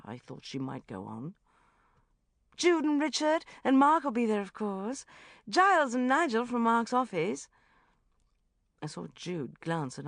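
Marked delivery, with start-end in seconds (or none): 2.6-7.5 high, loud society gush. A narrator's reading. Rebecca listing guests.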